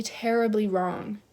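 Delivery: normal talking voice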